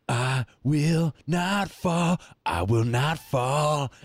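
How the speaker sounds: high, slow, drawn-out mock vow; mock dramatic vow. Imitating the surfer in the movie scene, drawn-out mock vow. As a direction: ham it up like a surfer willing himself not to wipe out. Surfer willing himself through a wave.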